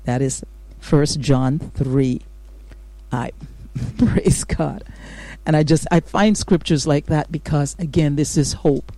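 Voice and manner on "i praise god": breathy laugh running into praise God. Real, not acted. Laughing after reading scripture.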